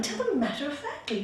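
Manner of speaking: ordinary delivery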